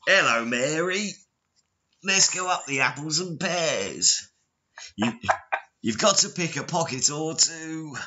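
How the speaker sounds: high, hammed-up fake Cockney accent; American doing Cockney. Showing co-host how Americans do British, hammed-up bad Cockney accent. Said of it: "that's my American doing a Cockney accent".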